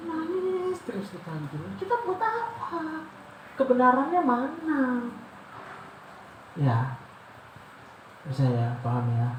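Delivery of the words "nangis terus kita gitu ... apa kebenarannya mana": high mocking whine. Mocking crying worshippers.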